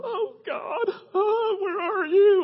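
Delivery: high, tearful whine dragged out; put-on helpless wailing prayer; mock desperate wail. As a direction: cry out to God in hammed-up despair. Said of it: "I don't go".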